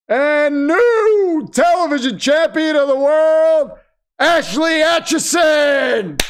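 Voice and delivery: hammed-up wrestling announcer naming the champion; ring announcer. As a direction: draw out every word like a ring announcer. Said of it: "gonna be a loud one".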